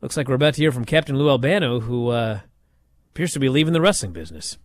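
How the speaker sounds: a hint of a low, quick announcer read; low, quick mock play-by-play voice; lightly voiced Gorilla Monsoon. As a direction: mutter it fast like a TV commentator backing down. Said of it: "He goes".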